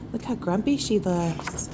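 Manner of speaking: plain reading voice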